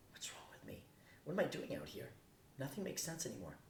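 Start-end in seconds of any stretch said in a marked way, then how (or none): 0.1-0.8 whispered through tears; crying whisper. Acted. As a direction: break down into a hushed whisper. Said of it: "I realized I am".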